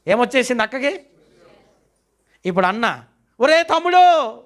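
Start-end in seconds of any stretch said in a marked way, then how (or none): 3.4-4.3 high, loud panicked call to a brother; a panicked brother's high cry; frightened brother crying out. Retold or imitated. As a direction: cry out to him in alarm. Aaron crying out in fear.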